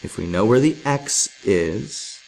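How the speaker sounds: ordinary delivery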